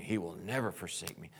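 ordinary talk

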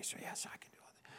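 fast, breathy whispered affirmation. Acted. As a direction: whisper it to yourself quickly. Quietly affirming scripture to self.

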